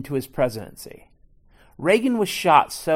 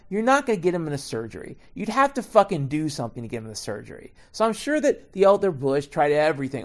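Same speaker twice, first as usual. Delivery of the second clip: ordinary delivery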